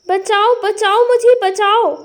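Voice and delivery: loud, hammed-up cry for help; screaming for help. Bride screams at the lizard, loud and hammed-up. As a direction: shriek it out, scared of a lizard. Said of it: "डरकर चीखने लगती है".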